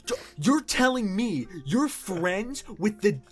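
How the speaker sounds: high, loud, disbelieving squeal; girlfriend freaking out. Retold or imitated. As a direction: shriek it like you can't believe it. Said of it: "she started freaking the fuck out".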